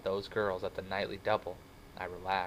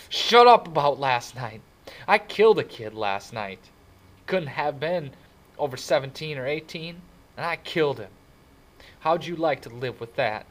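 loud, raised, anguished confession; crying outburst; 6 semitones higher, 10 dB louder than usual. A narrator's reading. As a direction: lash out in anguish, close to tears. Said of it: "He was crying".

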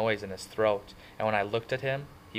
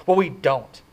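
louder, sharp and defensive; snapped; 5 semitones higher, 10 dB louder than usual. Acted. Ponyboy snaps at Johnny, embarrassed and defensive. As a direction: snap it back, cutting him off. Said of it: "I snapped".

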